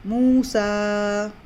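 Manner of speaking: मूसा with the ू held long, slowly; stretched vowel. Shown as a demonstration. Teacher drilling the class on a long ू in मूसा. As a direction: draw out मूसा, two counts on the ू. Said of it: "यहाँ भी दो हरकत खींचना है".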